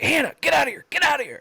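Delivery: put-on high, urgent cry to a child; re-enacted urgent call. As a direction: order your daughter away from danger, as retold. Father calls daughter away from goat.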